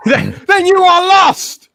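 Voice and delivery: over-the-top Sith declaration; mock Star Wars villain. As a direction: ham up the movie villain. Star Wars quote as a joke.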